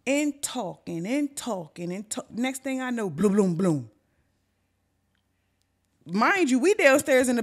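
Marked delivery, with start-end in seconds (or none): 3.1-3.9 loud tumbling thuds, "bloom, bloom, bloom"; imitated thudding. Storyteller recreating a noise from upstairs, imitated thudding. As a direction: voice the booming crash in quick beats. Imitates the crash heard from downstairs.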